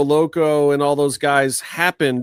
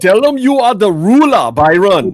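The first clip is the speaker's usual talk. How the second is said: announcer hype; 5 semitones higher, 8 dB louder than usual. Pretend. Hyping a wrestler's catchphrase.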